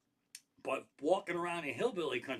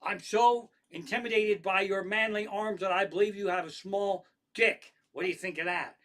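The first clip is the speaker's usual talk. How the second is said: loud, higher-pitched mock heckle; mock yelled taunt; 5 semitones higher, 5 dB louder than usual. Imagined heckler yelling at a stranger with arms, mock shout. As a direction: belt it out as an absurd taunt. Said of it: "you just fucking yell it out".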